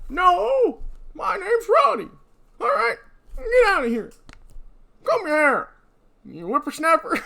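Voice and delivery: put-on high, slow, quavering old-timer; old prospector. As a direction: whine it like a rattled old codger. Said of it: "It just really works for this voice".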